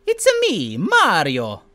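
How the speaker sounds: put-on Italian-accented Mario, high then dropping; bouncy Mario catchphrase in a cartoon Italian accent; Mario impression. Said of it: "at Mario's pad".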